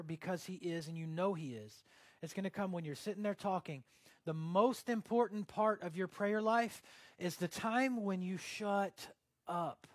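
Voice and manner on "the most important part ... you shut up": slow and louder, leaning hard on each word; slow, emphatic. Real, not acted. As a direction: spell out the key point slowly. Emphatic key point.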